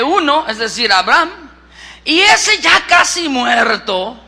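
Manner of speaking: emphatic scripture reading from the pulpit; predicación enérgica. Real, not acted. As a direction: preach it out forcefully, stretching key phrases. Predica leyendo la Biblia. (lectura bíblica proclamada con fuerza)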